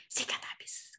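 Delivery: very soft whisper with no power; weak whisper. Shown as a demonstration. Teacher showing a whisper without power, barely audible. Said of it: "nggak kedengeran karena posisinya dia bisik".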